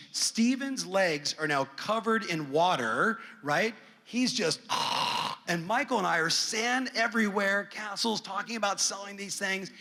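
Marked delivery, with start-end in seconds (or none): 4.6-5.4 long breathy snore imitation; imitated sleep noise. Dad imitating his son out cold on the beach, snoring.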